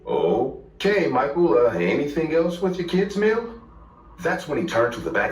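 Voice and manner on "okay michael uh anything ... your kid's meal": rising, awkward politeness. A narrator's reading. Worker taking Michael's kid's meal order, awkward. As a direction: say it awkwardly, pitch climbing as you go. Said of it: "said in an awkward voice".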